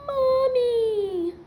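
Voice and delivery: put-on high squeaky baby cry of Mommy; baby dragon cry. Baby dragon greeting George, squeaky cry. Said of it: "knew exactly what the dragon had said".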